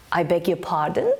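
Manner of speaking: "I beg your pardon" low, climbing on "pardon"; rising intonation. Shown as a demonstration. Teacher demonstrating a very British polite request, rising intonation. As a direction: lift "pardon" into a polite question. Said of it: "our intonation rises".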